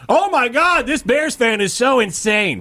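mock outrage. Host imitating outraged listeners, mock shocked. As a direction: play the scandalised fan. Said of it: "that's kind of like".

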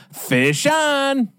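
high, drawn-out call with a falling end; fisherman's yell. Acted. Fisherman hooking a salmon on the charter, yelling. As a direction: holler it high like an angler with a bite. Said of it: "get ready to yell".

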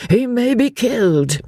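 high, worried plea; anxious cry. A narrator's reading. Old man fearing his boy is lost, crying out anxiously. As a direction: cry out in mounting alarm, pitch climbing. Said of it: "cried Mister Emerson".

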